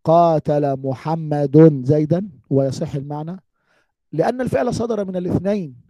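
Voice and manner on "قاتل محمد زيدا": slow, word by word, for the class; slow example sentence. Shown as a demonstration. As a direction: spell out the swapped example slowly. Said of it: "ألا يجوز أن نقول". (مثال نحوي يُقرأ ببطء كلمة كلمة)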